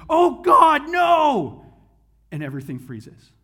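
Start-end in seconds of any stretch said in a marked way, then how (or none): 0.1-1.6 high, loud panicked plea to God; shouting a frantic last-second prayer; desperate cry. Retold or imitated. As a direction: cry out as the paw comes down. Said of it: "he goes".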